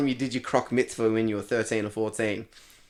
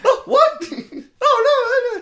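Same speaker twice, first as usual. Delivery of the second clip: very high, louder mock-shocked squeal; 5 dB louder, slower than usual. Comedian acts out a listener offended at the insult, mock shock.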